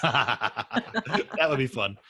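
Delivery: slow, laugh-filled words. Real, not acted. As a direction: say it while still laughing, drawn out. Laughing at guest's wild story.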